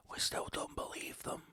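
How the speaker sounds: hushed conspiratorial whisper; whispered aside. Acted. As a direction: drop to a breathy secret. Whispered aside about distrust.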